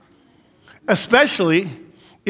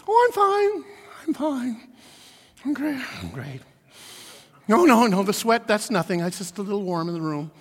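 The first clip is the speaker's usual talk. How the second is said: faking fine; 2 semitones higher, 4 dB quieter than usual. Pretend. Preacher mocking someone pretending to be fine while sweating. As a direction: fake a calm you don't feel. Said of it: "try to look peaceful and not be peaceful".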